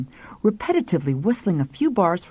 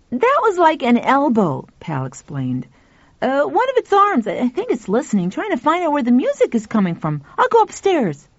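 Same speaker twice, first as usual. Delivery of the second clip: put-on excited boy voice; 6 semitones higher than usual. Pal speaks excitedly.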